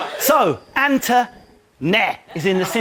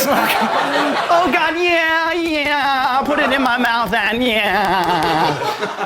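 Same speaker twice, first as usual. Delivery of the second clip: mock dim contestant; 3 semitones higher, much slower than usual. Mocking the contestant in a giggly airhead voice. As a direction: send her up as a giggly airhead.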